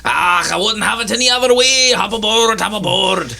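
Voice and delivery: put-on high, hollering old sea captain; hearty ship captain. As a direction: welcome them aboard in a high captain's voice.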